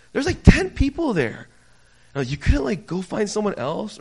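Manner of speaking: high, loud, incredulous complaint; incredulous protest. Retold or imitated. Customer asked for ID in a crowded arcade, incredulous. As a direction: protest as if it were absurd.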